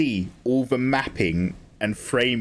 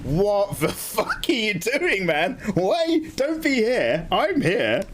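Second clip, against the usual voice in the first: mock exasperation; 8 semitones higher, slower than usual. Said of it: "I was just like".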